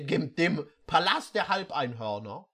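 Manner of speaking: ordinary talk, nothing marked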